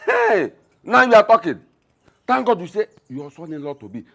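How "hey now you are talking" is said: mock surprised exclamation. Father reacts to son-in-law remark.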